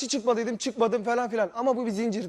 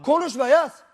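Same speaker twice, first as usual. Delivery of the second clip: angry shout; 4 semitones higher, 5 dB louder, slower than usual. Real, not acted. Shouting during a heated argument.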